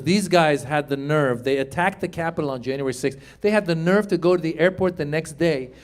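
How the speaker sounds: ordinary delivery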